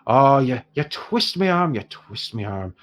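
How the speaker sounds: reluctant trader with an Irish lilt; Irish dwarf. Acted. Dwarf gives in to haggling heroes, mock-reluctant Irish accent. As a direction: give in grudgingly in an Irish accent. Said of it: "I've gone entirely Irish now".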